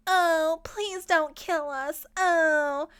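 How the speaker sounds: high mocking whimper; mocking plea. Baby mocking the captive, high mock plea. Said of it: "Baby mocks him".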